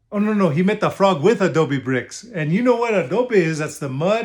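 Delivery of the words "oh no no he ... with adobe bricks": ordinary delivery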